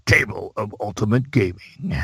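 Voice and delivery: lightly done radio ad announcer; a hint of booming ad voice. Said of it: "you know, that voice".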